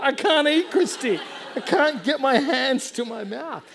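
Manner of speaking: high, loud comic wail; hammed-up distressed shouting; mock helpless complaint. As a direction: wail helplessly to your wife. Complaining to wife about sore arms.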